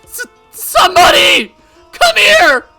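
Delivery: loud, high panicked scream; stammer, then shriek for rescue; screaming for help. Acted. Mion calling for somebody, screaming in terror.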